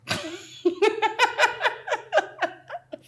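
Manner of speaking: high, loud cackling laugh; mocking laughter. Laughing at a friend's scheme to win the brother, high cackle. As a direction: burst out in long, high laughter.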